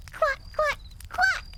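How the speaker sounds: very high, squeaky duckling quacks; proud quacking. A narrator's reading. Ducklings answering their mother, proud high quacks. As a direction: show off with bright little quacks. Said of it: "they said with pride".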